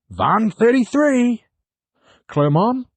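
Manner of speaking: high-pitched disbelief at the score; surprised. Real, not acted. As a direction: raise the voice in shock. Favourite team loses heavily.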